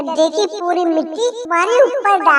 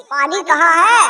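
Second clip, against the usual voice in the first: ordinary delivery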